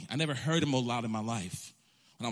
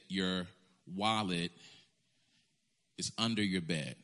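low, soft voice of the Holy Spirit, understated; calm inner voice; 8 semitones lower, 5 dB quieter than usual. Retold or imitated. Holy Spirit telling him where the wallet is, low and soft. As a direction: let the Spirit speak quietly and low.

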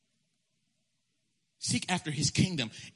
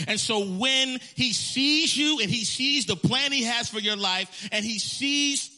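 higher, louder, slow with music behind; full-force preaching; 7 semitones higher, 5 dB louder, slower than usual. Acted. Preacher quoting Philippians to the church, fervent. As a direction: preach it with swelling fervor.

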